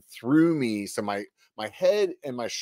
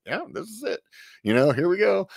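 resigned thought; 8 semitones higher than usual. Retold or imitated. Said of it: "thinking to myself, like".

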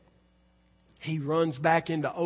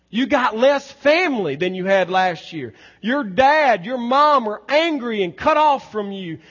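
loud, high, insistent pulpit voice; full-force preaching; 5 semitones higher, 9 dB louder than usual. Real, not acted. Preacher speaks to those cut off from family, preaching forcefully. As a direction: press each question hard, then answer it.

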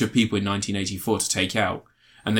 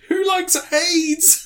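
high drawn-out taunt; much slower than usual. Retold or imitated. Retelling comedy scene, character voice.

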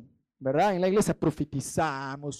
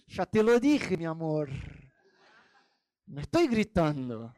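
passive-aggressive reproach. Retold or imitated. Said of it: "Empezás a decir así".

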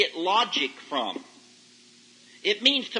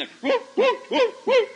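high squeaky whoop whoop of a toy dog; 5 dB louder, slower than usual. Retold or imitated. Preacher mimics the toy dog for the youth, high squeaky whoops. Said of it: "the dog will".

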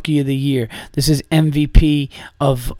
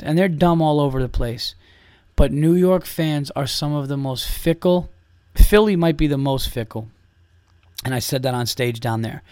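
ordinary delivery

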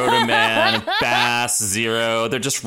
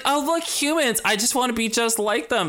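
much higher dreamy princess voice; mock Ariel; 11 semitones higher than usual. Ariel gazing at the humans, a high dreamy mock princess. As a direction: swoon like a wide-eyed mermaid. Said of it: "she's like".

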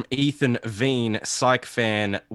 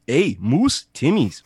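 Canadian accent warm-up; 2 semitones higher, slower than usual. Shown as a demonstration. Host warms up the Canadian voice with stock words, Canadian accent. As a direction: get back into a mock Canadian voice. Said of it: "Okay, I'm back in".

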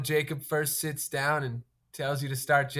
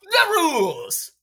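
announcer callout; 11 semitones higher, 6 dB louder, slower than usual. Acted.

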